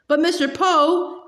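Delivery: high, slow indignant protest; boy protesting to the banker, indignant. A narrator's reading. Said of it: "Klaus said indignantly".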